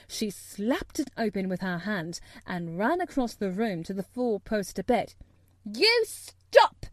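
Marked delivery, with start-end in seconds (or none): 5.6-6.9 put-on high, loud angry child's command; almost shouting. A narrator's reading. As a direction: shout it sharply at a screaming boy. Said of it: "she almost shouted".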